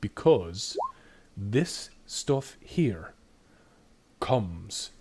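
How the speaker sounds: slow it down like a careful whiskey ritual; conspiratorial dwarf. Acted.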